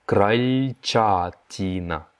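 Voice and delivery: крольчатина said very slowly, every syllable apart; slow teaching repeat. Shown as a demonstration. Teacher slowing крольчатина down for learners. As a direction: stretch крольчатина right out. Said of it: "slow repeat of vocabulary".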